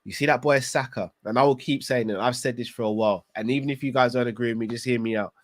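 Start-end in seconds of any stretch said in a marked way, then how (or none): none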